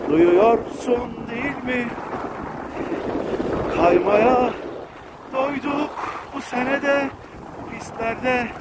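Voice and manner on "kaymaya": ordinary delivery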